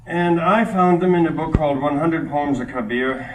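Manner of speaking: normal talking voice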